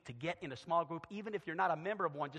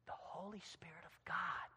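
very soft, near-whisper; hushed reverence; 12 dB quieter than usual. Acted. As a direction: say it in a reverent hush. Hushed after shouted climax.

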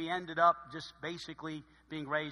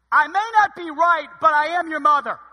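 high, loud bossy mom; mock mother authority; 8 semitones higher, 11 dB louder than usual. Preacher imitating his mother pulling rank, high and loud. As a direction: declare it like an unbeatable mom.

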